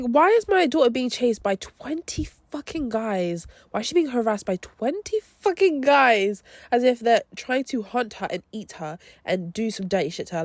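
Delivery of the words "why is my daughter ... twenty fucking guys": outraged mum. Retold or imitated. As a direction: confront the teacher as an indignant mother, stretching the key words. Mum confronts the teacher, retold.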